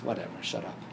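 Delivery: low, quiet sarcastic dismissal; imitating a sarcastic sibling; sarcastic brush-off. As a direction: wave it off sarcastically, under your voice. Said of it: "It's very sarcastic".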